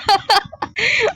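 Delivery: high, squealing burst of laughter; shrieking giggle. Real, not acted. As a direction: laugh out at Lola's antics. Laughing at Lola in game.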